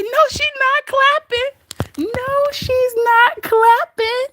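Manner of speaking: high, drawn-out squeal of disbelief; slow, high-pitched mock amazement; incredulous disbelief. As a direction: stretch it out high, hardly able to believe it. Retells own hysterical disbelief.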